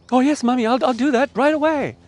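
put-on high, keen child voice; eager little boy. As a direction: promise it happily like a relieved kid. Forgiven child eagerly agreeing.

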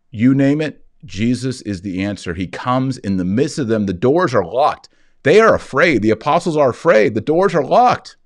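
rising high and loud, building tension; mounting pulpit fervour; impassioned preaching. Acted. Builds the locked-room scene.

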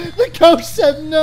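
excited. Real, not acted. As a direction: shout it with glee. Repeated in excitement.

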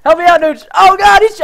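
high, loud, real alarm; excited battle shouting. Real, not acted. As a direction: yell to your teammate mid-fight. Excited attack, calling to teammate for help.

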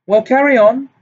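shrill-free but high and loud, near hysteria; high hysterical. A narrator's reading. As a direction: bark the order high and loud, barely holding together. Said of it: "said Professor Trelawney loudly, her voice high-pitched and somewhat hysterical".

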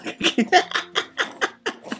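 high, breathy giggling fit; helpless giggle; high laugh. Real, not acted. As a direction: burst of high-pitched laughter. Laughs at odd discovery.

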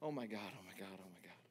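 "oh my God" breathed soft and low, fading out; stunned whisper. Shown as a demonstration. Preacher showing how we'd say it today, whispered. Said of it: "we would have said it like this".

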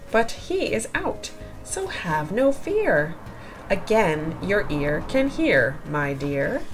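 ordinary delivery

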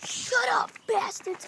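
soft, half-whispered telling-off; quiet whispery rebuke; hushed scolding. Real, not acted. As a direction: scold the pets under your breath. Muttered at noisy chickens.

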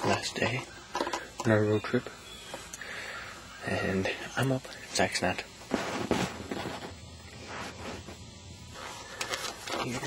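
very soft, low-key voice; hushed. Real, not acted. Vlogging beside sleeping Zach on the last morning, hushed.

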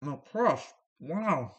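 chewing while talking; eating pizza, muffled words; mouth full. Real, not acted. Seen 0: bit slice just before; holds it by mouth, lips pressed while speaking.

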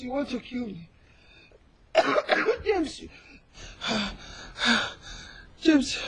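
weak and coughing. Acted. Sick mother coughing, dying.

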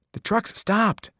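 lightly voiced anxious goat boy; Grover. A narrator's reading. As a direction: whisper it urgently as Grover.

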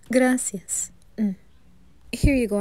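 ordinary delivery

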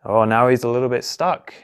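usual speaking voice

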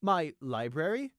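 hesitant, drawn-out query; uncertain. A narrator's reading. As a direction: echo it back, thrown off balance. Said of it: "he asked uncertainly".

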